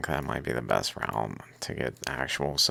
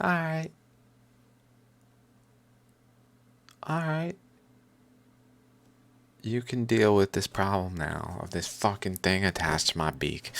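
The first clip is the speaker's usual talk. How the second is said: slightly higher relieved bird voice; lightly voiced calm bird; 5 semitones higher than usual. Bird letting him remove the thing, relieved. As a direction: let the bird accept help. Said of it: "it was like".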